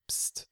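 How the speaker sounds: sharp soft psst; hissed shush. Acted. Dimitri hushes the others during the silence, hissed psst. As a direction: hush them with a hiss. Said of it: "zischte Dimitri".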